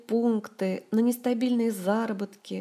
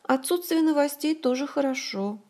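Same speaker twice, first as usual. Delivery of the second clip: higher-pitched, upbeat reassurance; cheerful summing-up; 7 semitones higher than usual. A narrator's reading. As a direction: sum it up brightly for a friend. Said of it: "бодро подытожила подруга".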